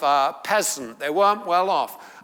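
usual speaking voice

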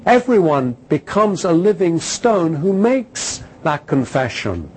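loud, rising preacher's declamation; full-force preaching. Acted. Preacher drives home his point to the congregation, loud and fervent. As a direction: proclaim it to the congregation.

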